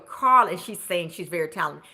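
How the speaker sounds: usual speaking voice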